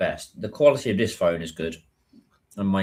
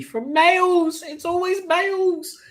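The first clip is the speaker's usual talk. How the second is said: mock whine; slower than usual. Real, not acted. Complaining males compliment her.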